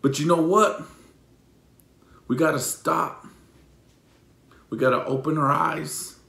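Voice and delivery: ordinary talk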